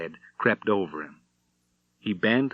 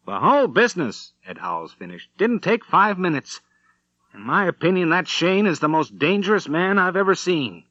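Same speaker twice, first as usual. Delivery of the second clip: higher and louder, still shaken; excited wrap-up; 8 semitones higher, 3 dB louder than usual. Acted. Ed Howells telling the family, awed and excited. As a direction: end the tale with awed urgency.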